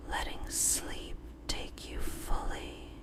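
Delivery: plain reading voice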